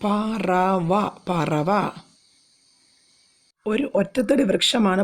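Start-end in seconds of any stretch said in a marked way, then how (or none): 0.0-1.9 slow, stretched പറവ, every syllable long; teacher stretching the word പറവ; drawn out syllable by syllable. Shown as a demonstration. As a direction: spell out പറവ very slowly for children. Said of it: "പക്ഷിക്ക് പറയുന്ന മറ്റൊരു പേരാണ് പറവ".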